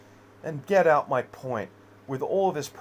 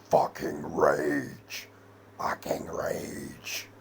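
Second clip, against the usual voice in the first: low menacing rage; 13 semitones lower, 5 dB quieter, much slower than usual. Real, not acted. Ranting about feeling only rage.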